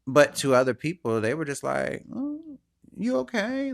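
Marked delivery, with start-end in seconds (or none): 2.1-3.7 imitating a worried friend, pitched up; mock concerned check-in. People pretending to check on him, high mock concern.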